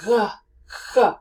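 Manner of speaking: "G" breathed out from the back of the throat. Shown as a demonstration. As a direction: breathe on the "G" until it rasps. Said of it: "Then breathe on that G".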